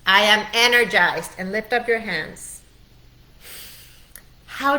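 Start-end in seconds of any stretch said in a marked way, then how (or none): 0.1-1.3 louder, higher declaration to be repeated; loud affirmation. Shown as a demonstration. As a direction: proclaim it so they shout it back. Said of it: "Repeat that out loud with me".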